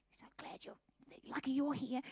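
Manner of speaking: hushed, grateful whisper; relieved whisper. Retold or imitated. Staff whispering to Susie, relieved she is there. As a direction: whisper thanks behind her back.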